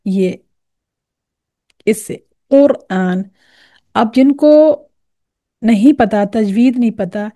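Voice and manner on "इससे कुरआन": deep throaty Qaf in कुरआन; Arabic Qaf from deep in the throat; correct Qaf. Shown as a demonstration. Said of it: "कौन सा वाला काफ?".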